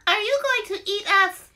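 put-on high, timid baby bird; tiny bird voice. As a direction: peep it like the tiniest chick. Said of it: "peeped the tiniest one".

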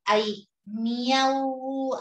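miau stretched very slowly as one syllable; slow triphthong demo. Shown as a demonstration. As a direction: draw out miau in one beat. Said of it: "ahí hay un triptongo".

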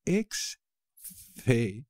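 slow model word. Shown as a demonstration.

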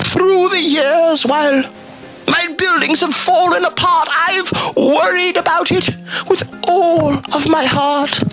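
ordinary delivery, nothing marked

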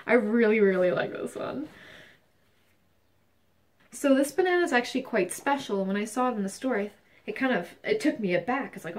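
ordinary talk

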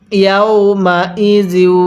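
slow, connected Quran syllables; measured teaching recitation; model recitation. Shown as a demonstration. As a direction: show how it reads, stretched out. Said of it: "আরেকবার পড়ুন".

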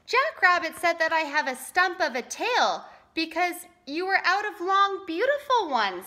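high-pitched, hurt complaint; mildly acted sniffling bobcat; sniffy. A narrator's reading. Bobcat complains of his stump tail, sniffily. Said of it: "sniffed Bobcat".

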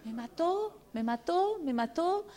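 devastated. Retold or imitated. Recalling shock at evaluation.

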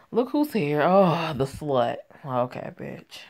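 ordinary delivery

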